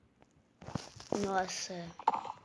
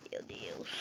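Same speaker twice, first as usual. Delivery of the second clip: breathy whispered "Meu Deus" under the breath; 16 dB quieter than usual. Real, not acted. Player sighing to herself at the wait, whispering. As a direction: let it slip out as a whisper. Muttering to self in exasperation.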